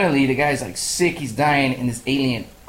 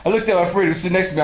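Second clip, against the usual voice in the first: ordinary talk, nothing marked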